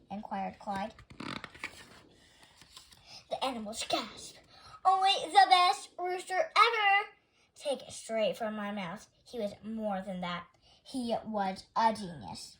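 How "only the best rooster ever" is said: excited. A narrator's reading. Animals gush about Larry.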